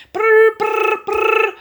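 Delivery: high loud ringing brr; alarm ring. Acted. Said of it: "went the alarm clock".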